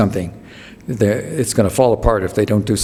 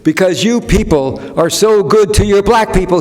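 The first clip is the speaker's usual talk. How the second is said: comic's stage address; 9 semitones higher than usual. Retold or imitated. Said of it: "He gets there and he says".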